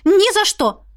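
high, loud refusal; exclamation. A narrator's reading. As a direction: cry out no. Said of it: "воскликнула я". (громкий возглас)

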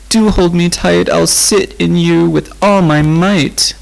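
lonely frightened lament; wailing. A narrator's reading. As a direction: plead in a trembling wail. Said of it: "wailed Rose".

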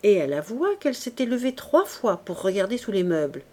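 usual speaking voice

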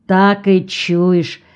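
ordinary delivery, nothing marked

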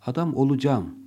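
Adam olacağım said as olucam; colloquial olacam. Shown as a demonstration. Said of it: "okursunuz veya söylersiniz".

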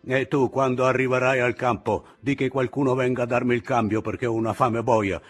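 sentry asking for relief; hungry sentry. Acted. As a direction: rattle off a complaint fast. Sentry figure voiced.

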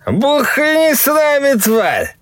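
very high, loud drawn-out holler; drunken yell. Acted. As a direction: bellow at the river. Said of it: "заголосил тот".

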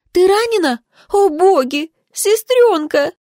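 alarmed sister. A narrator's reading. Sister rushing to the wounded heroine, frantic high alarm.

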